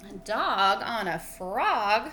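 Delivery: rising question tune on "A dog on a frog"; read as a question. Shown as a demonstration. Teacher reading the title to her class, asking it. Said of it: "read it like it's a question".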